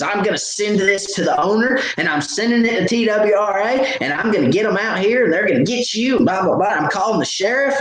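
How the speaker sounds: high-pitched mimicry of an angry woman; irate threats. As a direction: imitate her rattling off threats. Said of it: "She gets irate".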